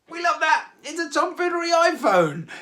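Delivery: high, loud burst of delight; excited gushing. Real, not acted. Host delighting in the winner's phone drawing, excited.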